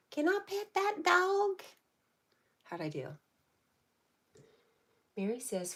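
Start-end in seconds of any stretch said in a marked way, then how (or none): 0.1-1.6 put-on high, slow, loud little-kid squeal; imitating that kid. Streamer imitating the viral kid asking about the dog, high and slow. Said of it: "Should I say it like that kid?".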